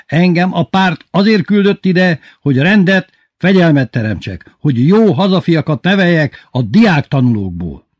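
high, loud official tirade; ranting headmaster. A narrator's reading. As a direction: hammer out the party mission. (hangos, dühös szónoklat)

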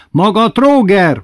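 high, loud, slow outraged shout; furious yell. Acted. Memike chasing the carriage, high furious yell. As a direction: scream it at the coachman's back. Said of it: "ordította".

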